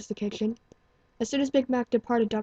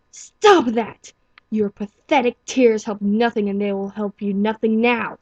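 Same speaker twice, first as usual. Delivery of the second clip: louder, slowed stern rebuke; harsh self-scolding; 9 dB louder, slower than usual. Acted. Stallion scolds himself for his tears, loud and stern. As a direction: bark at yourself to stop crying. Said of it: "he scolded himself silently".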